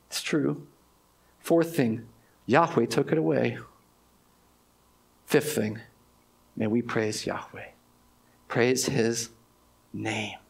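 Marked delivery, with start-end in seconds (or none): 5.2-10.4 low, soft and reverent; hushed praise. Real, not acted. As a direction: drop almost to a hush in worship.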